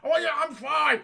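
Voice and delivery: high, loud brush-off; oblivious pilot. Acted. Porkins answering his wingmen, loudly oblivious. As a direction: play the doomed pilot waving it off. Doomed pilot insists he's fine.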